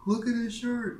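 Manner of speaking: a high shrill put-on old lady mocking a shirt. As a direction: squeal it like a mean old lady.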